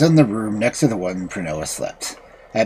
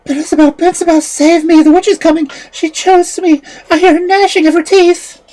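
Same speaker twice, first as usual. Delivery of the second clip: high, loud, terrified plea; agonized cry; 8 dB louder than usual. A narrator's reading. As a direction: cry out for rescue in panic. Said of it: "the agonized cry from the girl".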